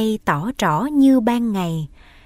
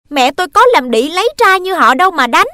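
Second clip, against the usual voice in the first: loud, high, fast; boy insults stepmother, loud and high; furious insult; 8 semitones higher, 8 dB louder, faster than usual. Acted. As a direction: fling the insult.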